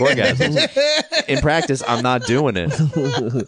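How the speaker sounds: laughing through the words. Real, not acted. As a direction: crack up mid-sentence. Laughing through tantric [name] joke.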